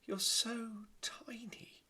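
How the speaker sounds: very high, slow and whispered; high breathy princess cooing; whispering princess. Acted. Princess to the tiny mouse, high whisper. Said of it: "said the princess".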